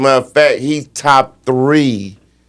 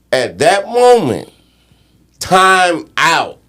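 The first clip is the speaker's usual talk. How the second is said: pitched-up, deliberate disbelief; exasperated emphasis; 6 semitones higher, slower than usual. Real, not acted. Analyst faulting the coach for a missed timeout, exasperated. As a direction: hammer each point, pausing between them.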